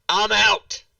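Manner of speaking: loud, high declaration of quitting; emphatic mock-outraged holler; retold exclamation. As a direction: act out giving up, shouting it. Acts out storming off in disgust.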